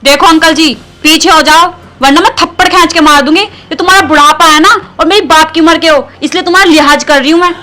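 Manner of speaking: loud, forceful telling-off; angry warning. Acted. Woman threatening to slap her friend's father-in-law, angry warning.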